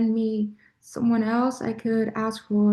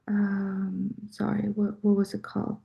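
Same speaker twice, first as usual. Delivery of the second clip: muttered aside to herself; 7 dB quieter than usual. Real, not acted. Searching for a word, aside.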